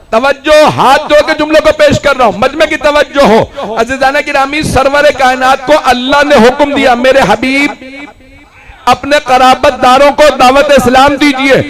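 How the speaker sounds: high, impassioned oratory building to a shout; ringing sermon crescendo; full-force preaching. Real, not acted. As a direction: drive the crowd up to a shouted climax.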